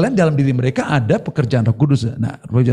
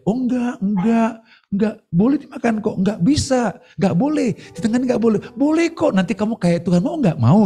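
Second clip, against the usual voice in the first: tempting devil voice; 6 semitones higher than usual. Retold or imitated. The devil coaxing Eve to eat the fruit, sly and high. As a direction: coax her like a cunning tempter. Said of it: "Dia ngomong, dia".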